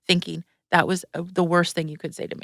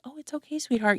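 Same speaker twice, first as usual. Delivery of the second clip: much softer, gently comforting; soft reassurance; 5 semitones higher, 7 dB quieter than usual. Retold or imitated. Client comforting the sobbing speaker, soft and tender. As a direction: soothe her like a child. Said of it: "And she's like".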